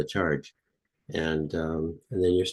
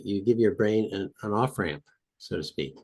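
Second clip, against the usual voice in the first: normal talking voice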